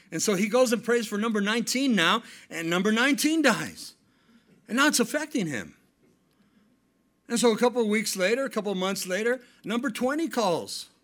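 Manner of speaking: loud, high storytelling preacher; full-force preaching. Acted. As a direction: build the tale up for the crowd.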